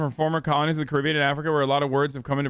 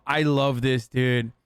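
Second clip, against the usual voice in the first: ordinary delivery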